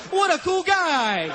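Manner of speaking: high, slow, mock admiration; hammed-up fond gushing; gushing praise. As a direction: draw it out in pretend awe. Acted fake praise of teacher.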